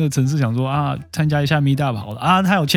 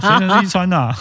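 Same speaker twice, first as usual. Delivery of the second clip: ordinary delivery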